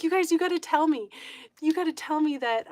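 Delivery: high, loud mock-desperate pleading; acted-out frantic appeal; retold urgent plea. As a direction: beg your friends to back you up. Retold plea before police officer.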